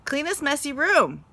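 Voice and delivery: mother repeating herself, getting upset; repeated. Shown as a demonstration. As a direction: say it again, running out of patience. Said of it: "repeated Mother".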